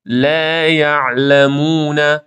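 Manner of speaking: slow, drawn-out chant of لا یعلمون; model recitation. Shown as a demonstration. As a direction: recite لا یعلمون with long, thin vowels. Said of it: "اینو امتحان کنید".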